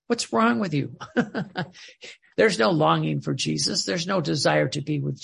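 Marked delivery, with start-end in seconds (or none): 0.1-2.4 laughing through a rhetorical question. Real, not acted. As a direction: tease them with a real laugh. Laughing at imagined excuse.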